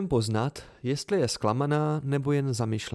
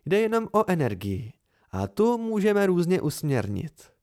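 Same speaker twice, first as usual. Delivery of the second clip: put-on patient, higher, as if explaining to a child; 5 semitones higher, 3 dB louder than usual. A narrator's reading. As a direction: spell it out gently as for a kid. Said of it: "jako když něco vysvětluje dítěti". (Dena vysvětluje Simovi jako dítěti, trpělivě a výš)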